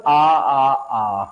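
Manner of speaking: long drawn-out आ held for six beats; prolonged aa. Shown as a demonstration. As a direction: stretch आ, आ, आ into one long vowel. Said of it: "क्या बोलूंगा?". (लंबा खींचा हुआ आ)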